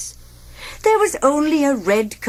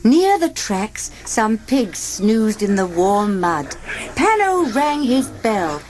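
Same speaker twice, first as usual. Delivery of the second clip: ordinary delivery, nothing marked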